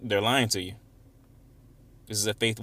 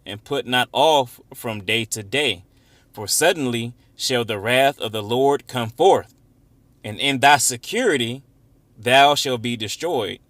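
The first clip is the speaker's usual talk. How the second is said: slow, forceful scripture reading; 4 dB louder, slower than usual. Acted. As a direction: declaim the warning slowly, stressing key words. Reads scripture aloud.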